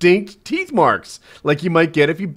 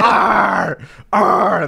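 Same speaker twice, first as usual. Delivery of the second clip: mock growling brute, higher, louder; caveman growl; 6 semitones higher, 4 dB louder than usual. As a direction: growl like a wordless caveman fighter. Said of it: "the other guy's like".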